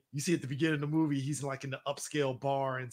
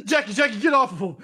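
higher and louder, laughing call; laughing shout; 7 semitones higher, 9 dB louder than usual. Real, not acted. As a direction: yell at the screen while cracking up. Laughing, calling out at video.

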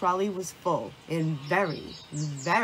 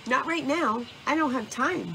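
higher, busy brush-off; hurried refusal; 6 semitones higher than usual. A narrator's reading. Busy Squirrel brushes off Mouse's invitation to play, hurried. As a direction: turn down the invitation as if rushing off.

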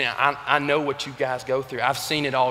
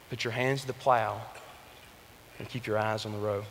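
hushed repeat; 8 semitones lower, 12 dB quieter than usual. Retold or imitated. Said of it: "is put your hands to the plow".

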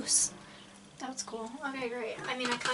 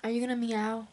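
usual speaking voice

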